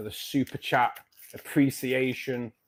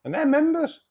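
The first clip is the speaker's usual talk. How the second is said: laughing; 7 dB louder than usual. Real, not acted. Laughs at his own complaint.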